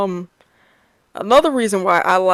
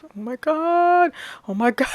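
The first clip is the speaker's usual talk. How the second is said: mock scared; 7 semitones higher, 4 dB quieter than usual. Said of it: "I'm like".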